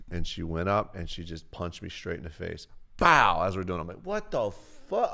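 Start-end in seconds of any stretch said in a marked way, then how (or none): 4.0-5.1 high, drawn-out disbelief; mock shock. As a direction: act out stunned protest, stretched and high. Retells reaction to being punched.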